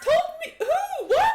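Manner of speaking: very high, loud squawked questions; incredulous outburst. Acted. Game master as Archeon, told of the bottle, incredulous. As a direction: shriek each question in disbelief. Archeon's baffled objection, acted.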